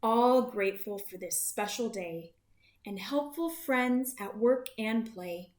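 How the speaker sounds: usual speaking voice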